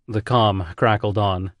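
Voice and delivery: usual speaking voice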